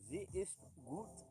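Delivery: "Sie ist gut" stretched slowly with a rise on "ist"; slowly savouring "Sie ist gut"; slow, drawn-out German. Shown as a demonstration. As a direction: linger on each word of "Sie ist gut". Repeating German phrase slowly.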